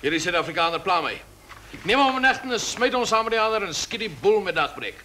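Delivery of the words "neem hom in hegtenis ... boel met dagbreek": barked orders. Acted. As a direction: order the execution. Ordering arrest and execution.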